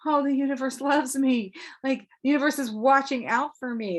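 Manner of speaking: high, loud voiced delight; gushing, high-pitched joy; acted enthusiasm. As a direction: exclaim it as if suddenly feeling adored. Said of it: "This is an extra enthusiasm".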